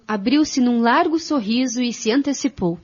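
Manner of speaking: ordinary talk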